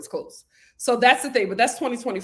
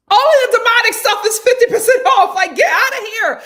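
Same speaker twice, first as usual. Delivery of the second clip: high, loud laughing outrage; loud laughing outburst; laughing disbelief; 11 semitones higher, 12 dB louder than usual. Real, not acted. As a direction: laugh it out in mock shock. Laughing outrage at demonic sale.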